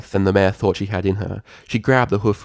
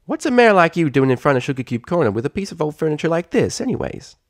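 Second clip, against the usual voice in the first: curious filly; 8 semitones higher than usual. Acted. Applebloom quizzing the mare, curious filly voice. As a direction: wonder aloud like a nosy little pony.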